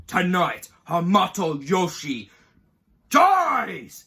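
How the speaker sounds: loud, drawn-out proclamation; mock villain building to a loud last word; imitated villain threat. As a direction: declare a death sentence, rising to a yell. Acts out villain's threat before the kill.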